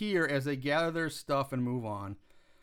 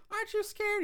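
put-on much higher, timid girly voice; high squeaky quote; 3 dB quieter than usual. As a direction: squeak it out like a scared girl. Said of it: "She's like".

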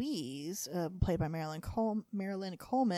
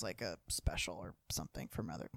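whisper; 11 semitones lower, 10 dB quieter than usual. Real, not acted. Whispered aside to her co-host. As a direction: drop to a near whisper.

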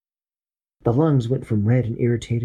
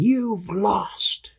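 germ's taunt; 11 semitones higher than usual. Acted. Germ gloats.

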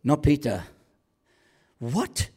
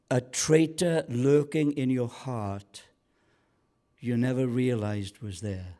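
ordinary talk

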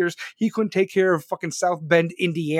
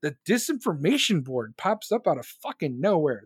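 usual speaking voice

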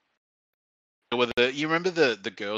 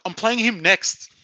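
high, loud, drawn-out mock announcement; imitated excited challenge, pitched way up; eager declaration; 11 semitones higher, 7 dB louder, slower than usual. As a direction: act out a mate loudly calling the next game. Friend's eager declaration retold, acted out.

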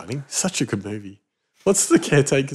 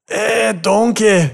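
put-on higher, slow Scottish-accented Shrek voice; Mike Myers as Shrek; 7 semitones higher, much slower than usual. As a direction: drag it out like a Scottish ogre. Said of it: "Mike Myers saying".

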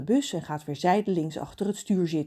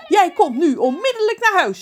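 much higher, loud furious yell; mother screaming; 13 semitones higher, 9 dB louder than usual. Acted. Angry mother screaming at Ron through the phone, high and loud. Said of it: "schreeuwt Rons moeder".

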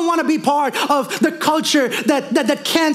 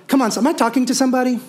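ordinary talk

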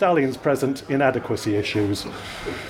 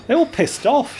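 put-on chirpy drunk woman, pitched up; breezy high drunk chatter; tipsy woman voice, high; 12 semitones higher than usual. Drunk woman answers policeman.

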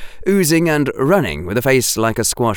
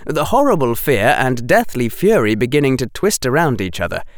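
ordinary delivery

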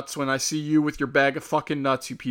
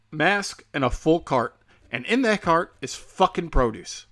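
ordinary talk

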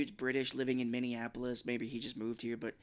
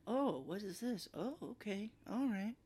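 put-on unbothered girl; 8 semitones higher, 5 dB quieter than usual. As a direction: shrug it off, curious and unfazed.

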